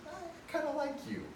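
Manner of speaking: soft, very high voice; tiny, timid admission of a crush; acted-out bashful high murmur; shy confession. Imagined admirer making shy confession.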